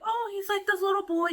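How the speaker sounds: put-on high-pitched child's voice; little boy. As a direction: answer as a small boy, pitched well up.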